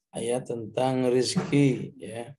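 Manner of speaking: ordinary talk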